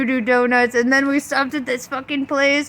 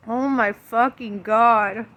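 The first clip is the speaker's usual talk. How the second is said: exasperated groan; slower than usual. Real, not acted. Recalling a hard Ramadan.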